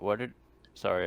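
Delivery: ordinary delivery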